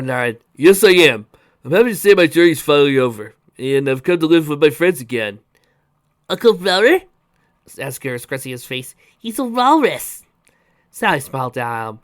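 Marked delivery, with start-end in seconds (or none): 0.6-5.4 loud, hearty announcement; loud, slightly raised character voice; Rudder's character voice. A narrator's reading. 6.3-7.0 put-on high, loud child's question; raised boyish voice with rising end; small boy. A narrator's reading. Young boy questions his mother. 9.2-10.2 put-on high, loud, slow child's voice; loud high-pitched boyish objection; small boy. A narrator's reading.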